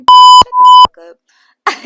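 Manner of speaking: slow, very high, loud scream; hammed-up screamed shut up. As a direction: scream it out, drawn out. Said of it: "I was like".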